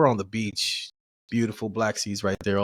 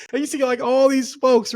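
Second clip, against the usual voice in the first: laughing; 12 semitones higher, 4 dB louder than usual. Real, not acted. Laughing while telling.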